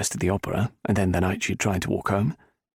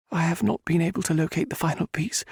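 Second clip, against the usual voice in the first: higher woman's voice; admitting; 9 semitones higher than usual. A narrator's reading. Heroine admitting to the Viscount she lacks proof. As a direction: concede it reluctantly. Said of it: "she admitted".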